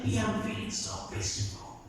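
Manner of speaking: whispered, soft and content; hushed whisper. Retold or imitated. Peter whispering to Jesus on the mountain, softly content. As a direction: breathe it like a secret calm.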